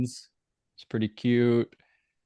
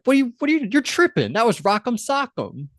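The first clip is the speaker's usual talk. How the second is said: squeaky, much higher incredulous Faith; higher voice, mocking disbelief; 11 semitones higher than usual. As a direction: pitch way up like a scoffing girl.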